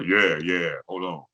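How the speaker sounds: put-on grown man on the phone; changed, dropped voice. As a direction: answer as the mistaken dad, voice dropped. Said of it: "I got to talking like this".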